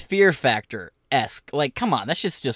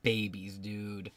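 muttered disgust; 6 semitones lower, 13 dB quieter, much slower than usual. Real, not acted. Muttering at clip.

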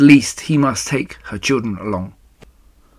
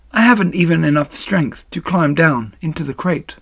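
higher, gentle voice for a failing spider; dying spider, resigned; 5 semitones higher than usual. Acted.

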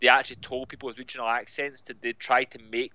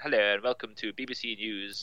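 put-on clipped English accent; 2 semitones lower, 5 dB quieter than usual. Old BBC broadcaster greets listeners in clipped received English. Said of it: "the very clipped English accent".

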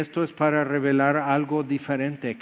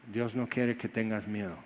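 soft, lower, gentle refrain; tender assurance; 4 semitones lower, 8 dB quieter than usual. Real, not acted. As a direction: speak it quietly as comfort. (suave y tierno)

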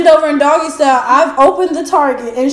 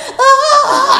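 mock choked moan; 10 semitones higher than usual. Shown as a demonstration. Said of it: "I don't need to be moaning like that".

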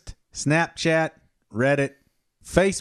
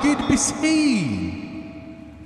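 slow, drawn-out character greeting; Mothra's agent; 2 semitones higher, slower than usual. Acted. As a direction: stretch it out in a hammed-up put-on voice. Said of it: "Mothra's agent".

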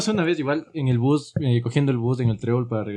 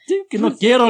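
raised, higher and louder retort; retold shout; 10 semitones higher, 6 dB louder than usual. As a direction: act out shouting a refusal. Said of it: "y le grité".

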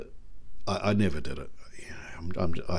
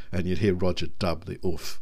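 normal talking voice

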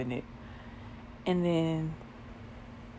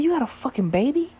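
shocked; 8 semitones higher, 4 dB louder, faster than usual. Retold or imitated. Shocked question in the story.

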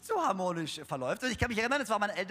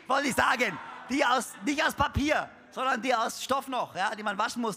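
normal talking voice

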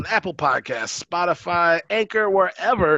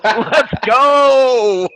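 laughing cheer; 9 semitones higher, 9 dB louder than usual. Real, not acted. Laughing hype reaction.

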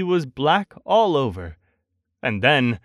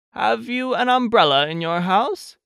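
higher, polite Pooh question; lightly voiced storybook bear; 8 semitones higher than usual. Pooh asks Christopher Robin for an umbrella, lightly voiced bear. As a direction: lift the voice slightly, asking earnestly.

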